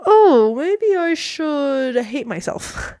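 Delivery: mock-wallow in self-pity, slowly. Speaker voicing own insecurity after comparing herself, mock self-pity. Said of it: "It's basically how I feel".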